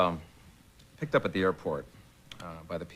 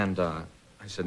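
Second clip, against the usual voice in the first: ordinary delivery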